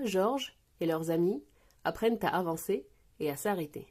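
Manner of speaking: ordinary delivery, nothing marked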